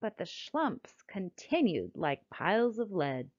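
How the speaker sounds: lively, sing-song storybook reading; reading to children. A narrator's reading. Narrator reading a rhyming picture book to children, lively and sing-song. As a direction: read it like bedtime rhyme time for kids.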